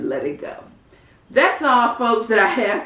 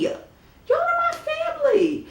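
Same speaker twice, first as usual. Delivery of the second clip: much higher, sing-song are my family; playful high repeat. Shown as a demonstration. Vlogger hyping subscribers as family, high and playful. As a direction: toss the line up high and playful.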